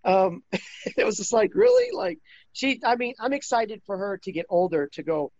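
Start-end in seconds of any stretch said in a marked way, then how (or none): 1.5-1.9 loud, very high mock squeal; exaggerated incredulous falsetto; high-pitched disbelief. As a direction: shoot the pitch up, louder, as if floored. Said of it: "I got all high pitched there".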